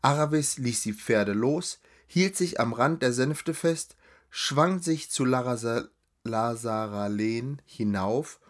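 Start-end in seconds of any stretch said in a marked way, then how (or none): none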